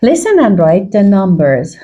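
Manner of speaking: very slow, careful reading; slow instruction read. Shown as a demonstration. Teacher reading the exercise instruction to the class, slowly. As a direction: read the task slowly to learners. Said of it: "reads the instruction slowly".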